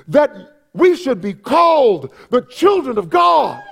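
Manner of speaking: high, ringing proclamation; fervent pulpit cry; impassioned preaching. Real, not acted. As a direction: proclaim it to the whole congregation. Preaches at full force.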